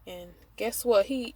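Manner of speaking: ordinary talk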